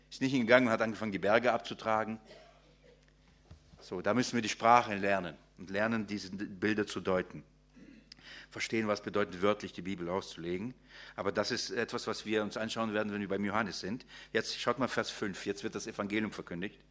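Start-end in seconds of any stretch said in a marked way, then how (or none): none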